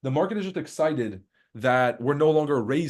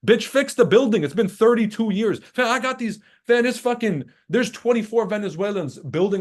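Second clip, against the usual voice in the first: higher, louder, fed-up yelling; exasperated rant; 7 semitones higher, 4 dB louder than usual. Real, not acted. Fed up with New York scaffolding, yelling in exasperation. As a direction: yell it like the building has wronged you. Ranting at the building owners.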